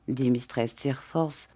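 ordinary delivery, nothing marked

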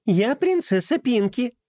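high, sweet princess voice; giggly princess. Acted. Pinky introducing herself to the newcomers, sugary giggle. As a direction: giggle then announce your title.

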